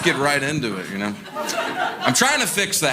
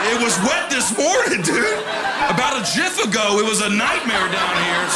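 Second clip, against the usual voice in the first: indignant protest; 7 semitones higher than usual. Retold or imitated. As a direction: insist hard, voice climbing. Defensive protest to plumber.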